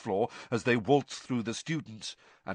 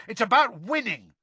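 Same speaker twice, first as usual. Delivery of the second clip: high, forceful angry shout; 12 semitones higher, 5 dB louder than usual. A narrator's reading. Ron arguing about the tournament, shouting. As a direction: hammer the point home loudly. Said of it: "shouted Ron".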